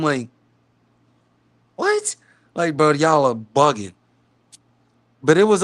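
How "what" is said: sudden squeaky high disbelief; a shocked, sky-high what; incredulous what. Retold or imitated. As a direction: jump way up in pitch, stunned. Incredulous reply to smack request.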